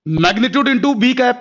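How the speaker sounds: "magnitude into B cap" said very slowly; slow dictation. Shown as a demonstration. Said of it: "क्या लिख सकते हो". (उत्तर बहुत धीरे धीरे लिखवाना)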